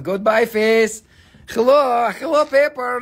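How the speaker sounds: high, loud sing-song hello; playful announcer hello; cheery greeting. Real, not acted. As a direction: greet the paper like a friend. Camera turned to paper.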